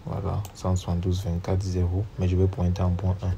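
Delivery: normal talking voice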